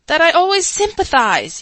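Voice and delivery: loud squeaky yell. A narrator's reading. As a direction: yell it into the silence. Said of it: "yelled the Chancellor".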